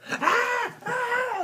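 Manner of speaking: very high loud shriek; imitated scream. Boy showing how he shrieks when mom comes, very high shriek. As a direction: shriek like a scared kid. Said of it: "like that".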